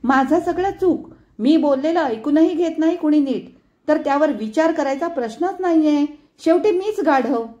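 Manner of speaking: high, loud self-pitying complaint; loud wounded rant; bitter outburst. A narrator's reading. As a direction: lash out, hurt and sarcastic. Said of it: "खवडून Sujata म्हणाली".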